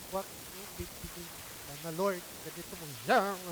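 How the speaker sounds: soft, earnest prayer to the Lord; modeled prayer. Shown as a demonstration. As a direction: pray it quietly, from the heart. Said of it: "But pray". (mahinahong panalangin sa Panginoon)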